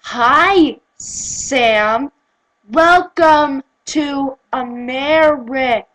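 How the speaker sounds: loud, slow teacher to a foreigner; over-enunciate loudly for someone without English; patronizing slow speech. Retold or imitated. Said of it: "they act like I'm coming from".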